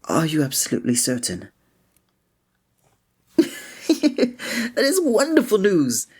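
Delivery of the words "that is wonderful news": high, slow laugh of pure delight; bright laughing relief. Acted. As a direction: laugh with joy at the good news. Delighted at the listener's answer.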